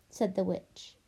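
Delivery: ordinary delivery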